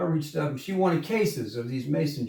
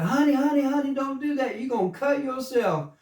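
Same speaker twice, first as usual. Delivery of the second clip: high, pleading honey honey honey; old lady's high warning; 11 semitones higher than usual. Retold or imitated. Older lady warning the boy about broken glass, high and urgent. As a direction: mimic the worried older lady, pitched up. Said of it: "And she's like".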